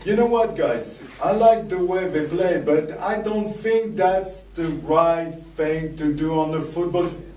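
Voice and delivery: put-on high, loud French accent sports talk; mock French-accented commentator. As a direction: ham up a French accent as a pundit. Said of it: "it was very funny to speak like that".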